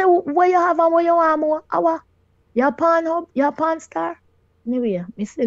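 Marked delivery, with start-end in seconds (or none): 0.0-4.2 higher and louder disbelieving demand; shocked question. Retold or imitated. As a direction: throw it at him in disbelief. Acted quote asking husband, shocked.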